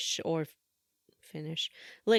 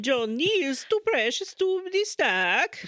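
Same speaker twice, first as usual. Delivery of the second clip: mock Swedish accent, pitched high; a hammy Swedish voice, high and bright; 8 semitones higher, 4 dB louder than usual. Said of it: "the Swedish voice".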